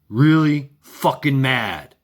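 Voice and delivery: normal talking voice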